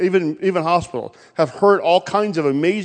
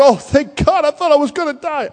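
relieved; 8 semitones higher than usual. Retold or imitated. Man hanging from tree relieved.

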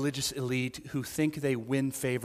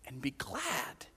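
slow, barely voiced; whisper; 11 dB quieter, slower than usual. Real, not acted. Father telling his son to rejoice, slow and whispered. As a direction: let it fade into a slow whisper. Gentle close of the father's words.

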